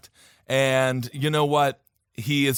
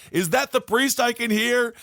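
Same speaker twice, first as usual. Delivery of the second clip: lightly voiced man in a coffin; higher-pitched comic calling; trapped dead man; 7 semitones higher than usual. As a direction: call out hopefully from the casket.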